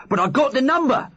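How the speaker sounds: high, loud excited cry; bursting with pride; excited shout. Acted. Wobbler hopping about telling the others, excited high shout. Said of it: "shouted Wobbler".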